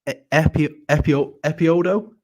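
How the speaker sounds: E-, Epi-, Epio-, Epiodo? sounded out in halting tries, rising toward a guess; halting attempt to sound out the name Epiodo; unsure pronunciation. Real, not acted. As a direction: stumble through Epiodo syllable by syllable, guessing. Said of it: "I don't know how to pronounce your name properly".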